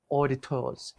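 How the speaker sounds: auditors in a clumsy British accent, crisp t; bad British accent. Shown as a demonstration. Linguist demos auditors in a bad British accent. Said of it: "That sounded like a terrible British accent".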